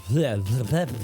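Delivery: ordinary delivery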